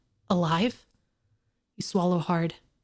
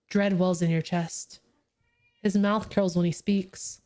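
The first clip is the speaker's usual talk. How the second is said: ordinary delivery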